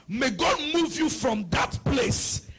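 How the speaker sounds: high, loud declaration over the congregation; fervent preaching prayer. Real, not acted. Preacher blessing the congregation's work, fervent shouted prayer.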